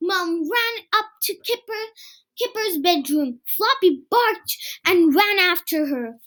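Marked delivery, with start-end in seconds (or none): none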